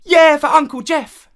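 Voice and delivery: put-on loud, high-pitched children's cheer; shouted cheer. A narrator's reading.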